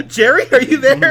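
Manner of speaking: high, loud laughing call. Real, not acted. Laughing while talking.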